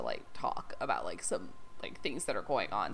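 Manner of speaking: imitating a serious we-need-to-talk voice; hushed ominous quote. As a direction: drop to a grave hush.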